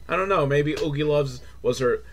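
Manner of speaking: normal talking voice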